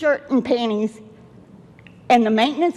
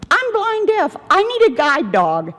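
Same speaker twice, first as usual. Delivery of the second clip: higher, pleading emphasis; emphatic; 7 semitones higher, 3 dB louder than usual. Real, not acted. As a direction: stress being blind deaf. Pleading at city council.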